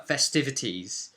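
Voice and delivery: ordinary talk, nothing marked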